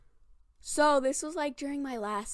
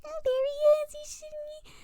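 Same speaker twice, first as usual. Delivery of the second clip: put-on high, squealy girlfriends egging her on; giddy, high-pitched mock teasing; teasing friends; 12 semitones higher, 4 dB louder than usual. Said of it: "teasing me".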